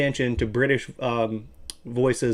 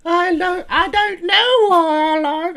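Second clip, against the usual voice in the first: much higher, slow put-on British accent; high-pitched British dude; 5 dB louder, slower than usual. Mocking high-pitched British men, high British accent. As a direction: squeak it out like a posh British dude. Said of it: "really high pitched fucking British dudes".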